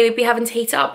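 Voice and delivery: usual speaking voice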